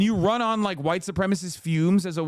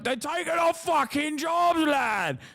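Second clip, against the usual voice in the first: high, shouted mock British lad; mock EDL lad; 9 semitones higher than usual. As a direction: ham up an angry English yob. Mocking an EDL guy.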